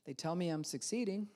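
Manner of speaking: resigned deadpan drone; flatline voice. Shown as a demonstration. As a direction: mumble it with no hope at all. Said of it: "you'll talk flatline".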